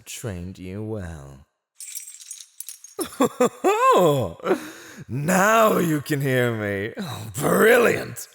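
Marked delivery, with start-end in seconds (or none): 1.8-5.0 high, loud delighted cackle; gloating villain laugh. Acted. Captive finally reacts. 5.1-8.3 loud, high gloating glee; mocking delight. Villain delighted captive reacts.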